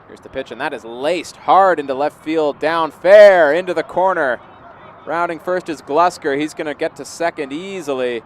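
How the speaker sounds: real excitement calling a live hit; excited play-by-play. Real, not acted. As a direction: build from the pitch into a big, loud call. Live call of a hard hit.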